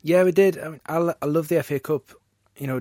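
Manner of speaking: loud, eager burst; excited. Real, not acted. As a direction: jump in with real enthusiasm. Keen fan bursts in.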